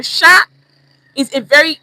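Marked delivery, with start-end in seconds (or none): 0.0-0.4 "sha" said higher and louder; teacher showing "sha" with a high loud pitch; emphatic sha. Shown as a demonstration. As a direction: ring out "sha" sharply. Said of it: "Sha, in Nigerian communication".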